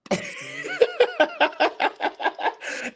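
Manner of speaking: long high-pitched cackle; laughing. Real, not acted. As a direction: burst into high, rapid laughter. Laughs at feeling like a granddad.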